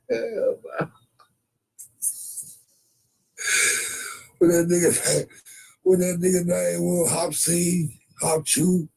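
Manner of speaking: high-pitched excited rancher; comic high-voiced cowboy; high put-on voice. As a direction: keep the voice pushed up high. High character voice, Bonanza riff.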